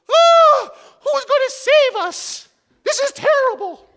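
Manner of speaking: squeaky very high mock cry for help; Minnie Mouse. Pastor voicing Minnie Mouse crying for rescue. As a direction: shriek it like a cartoon mouse in distress. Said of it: "And Minnie Mouse goes".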